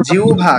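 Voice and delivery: emphatic demo of জিহ্বা, "u" then breathy-consonant "bh"; jiu-bha pronunciation. Shown as a demonstration. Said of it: "এটার উচ্চারণটা কিন্তু".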